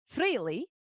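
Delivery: calling out. Acted. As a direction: call out to a friend for help. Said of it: "very familiar voice call out".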